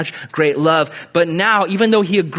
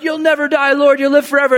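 high-pitched, hurried mock protest; naive Peter; 9 semitones higher, 4 dB louder, faster than usual. Disciple protests Jesus talking of his death, high mock protest. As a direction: insist in a raised, high voice. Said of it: "Peter's like".

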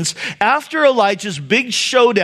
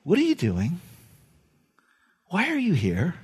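hushed and much softer, a gentle question; still small voice; 3 semitones lower, 8 dB quieter than usual. Acted. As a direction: ask it like a quiet nudge from within. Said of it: "that same still small voice".

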